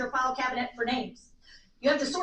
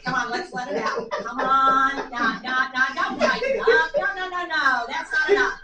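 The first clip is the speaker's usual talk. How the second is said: louder pleading to let go; urgent coaxing; 6 dB louder, slower than usual. Acted. Speaker urging an old bladder to let go, loud and coaxing. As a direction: coax it out, more urgent each time.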